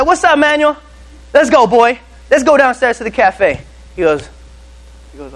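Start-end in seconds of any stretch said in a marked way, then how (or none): 0.0-3.6 high, loud hype greeting; rally a new recruit with gusto; pumped-up boss. Retold or imitated. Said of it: "and I'm all pumped".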